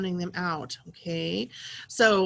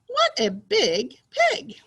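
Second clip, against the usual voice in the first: higher, slow excited exclamation; excited reading; 5 semitones higher, 4 dB louder, slower than usual. Shown as a demonstration. Teacher rereading the pig sentence for pupils, excited. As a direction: swoop up with excitement on each word. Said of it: "say it in an excited way".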